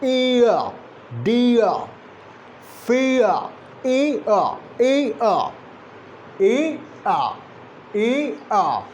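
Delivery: "ear" as a glide from "ee" to "uh"; diphthong drill. Shown as a demonstration. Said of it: "So this ear".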